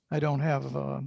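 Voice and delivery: normal talking voice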